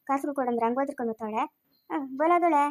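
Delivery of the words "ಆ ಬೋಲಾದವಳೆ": ordinary talk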